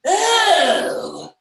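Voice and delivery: high, loud, slow whiny wife voice; imitating wife. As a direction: wail the reminder theatrically. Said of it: "It's like".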